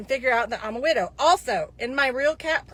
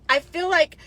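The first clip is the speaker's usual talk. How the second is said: normal talking voice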